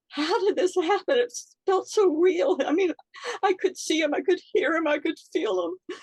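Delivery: high, strained and upset; emotional. Real, not acted. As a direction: voice rising as she relives it. Reliving the loss, emotional.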